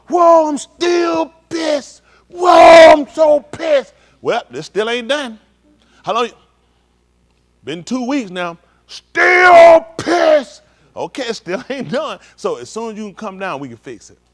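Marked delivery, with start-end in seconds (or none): 0.1-3.9 loud, high, drawn-out angry yell; mock pissed shout. Preacher acting out his own anger to the congregation, loud slow shout. 8.9-10.6 loud, high, drawn-out angry yell; mock pissed shout. Preacher acting out his own anger to the congregation, loud slow shout.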